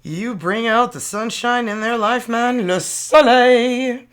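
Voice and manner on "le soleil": Le soleil slow, high and loud in a French accent; French card name. Shown as a demonstration. Naming the Sun card, slow loud French. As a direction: stretch out Le soleil like a proud Parisian. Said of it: "Here comes the sun".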